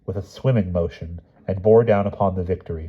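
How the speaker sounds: ordinary delivery, nothing marked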